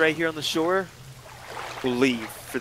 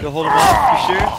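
incredulous; 10 dB louder than usual. Real, not acted. Friend offers to fight.

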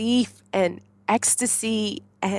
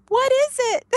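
much higher, loud screamed question; 6 dB louder than usual. Retold or imitated. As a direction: shout it out in joyful shock. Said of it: "I just screamed".

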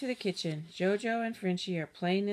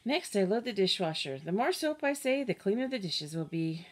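ordinary delivery

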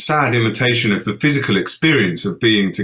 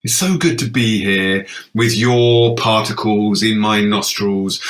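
normal talking voice

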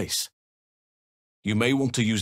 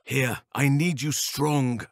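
normal talking voice

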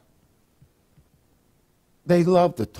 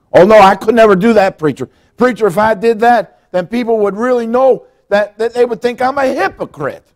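higher and much louder, hammed-up protest; a frightened churchgoer loudly begging off to the preacher; loud protesting believer; 7 semitones higher, 9 dB louder than usual. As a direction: play the believer who won't confess, loudly. Congregant refusing to confess, voiced.

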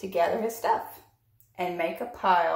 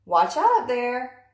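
louder, slow warning call; crying out; 5 semitones higher, 6 dB louder than usual. Acted. Trevor warning Pig on the wobbling pile, loud slow call. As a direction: call up a warning to someone about to fall. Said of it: "good Trevor did cry".

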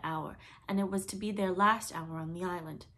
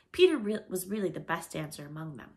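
plain reading voice

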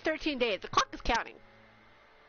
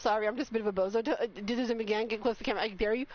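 ordinary talk, nothing marked

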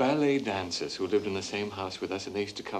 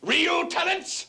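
much higher, drawn-out indignant echo; shrill outrage; much slower than usual. Acted. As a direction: throw her phrase back, outraged. Son repeats mother's phrase.

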